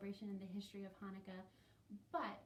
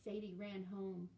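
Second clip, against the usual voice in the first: plain reading voice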